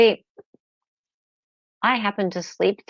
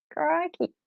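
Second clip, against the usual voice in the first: high, hammed-up Aussie "Crikey, mate"; hammed-up Australian; 10 semitones higher than usual. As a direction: play a cartoon Aussie calling out. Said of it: "You sound like an Aussie".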